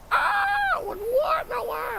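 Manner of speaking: hammed-up falsetto panic; mimicking a scream. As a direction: squeal like a scared gamer. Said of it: "I was just, like, mimicking".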